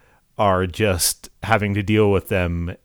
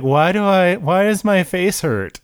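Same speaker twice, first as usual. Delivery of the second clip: high-pitched mock confusion; imitated bewildered character; 8 semitones higher than usual. Demon turned back to her normal self, imitated high puzzled voice. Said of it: "But she's just like".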